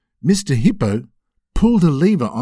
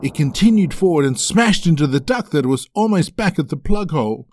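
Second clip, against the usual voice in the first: plain reading voice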